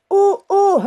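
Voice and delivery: alarmed little cry; dismay. Acted. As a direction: caught off guard as the pillow rips. The pillow tears.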